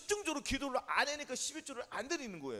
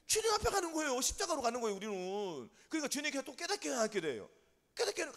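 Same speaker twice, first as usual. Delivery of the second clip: usual speaking voice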